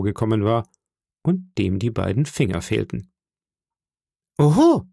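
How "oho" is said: loud high-pitched exclamation; startled cry. Acted. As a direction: cry out in sudden alarm. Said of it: "rief ich".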